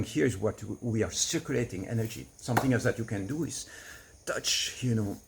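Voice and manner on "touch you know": normal talking voice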